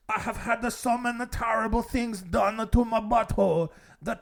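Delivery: lightly put-on French accent, playing the lost son; mock French accent. Mocking the impostor to the family in a French accent. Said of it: "a French accent".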